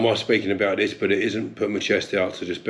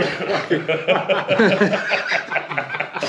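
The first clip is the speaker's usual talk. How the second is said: laughter; 9 semitones higher than usual. Real, not acted. Laughing after the joke.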